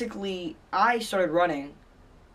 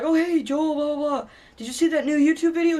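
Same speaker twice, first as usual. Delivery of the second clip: high, gushing mock-friendly chatter; over-eager buddy act, high and lifted; eager greeting; 9 semitones higher, 5 dB louder than usual. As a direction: imitate a friend chirping hello, pitched way up. Acting out friend greeting Joel eagerly.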